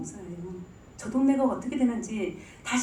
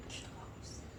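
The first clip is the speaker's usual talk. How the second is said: whisper; 23 dB quieter, faster than usual. Real, not acted. Aside after Jonah's prayer.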